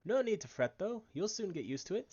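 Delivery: put-on a rough, hearty Irish accent, mostly held. Brennan the peddler waking the lass on the ship, in an Irish accent. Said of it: "I think it's Irish".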